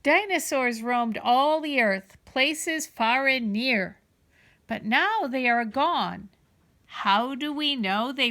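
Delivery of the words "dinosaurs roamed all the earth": plain reading voice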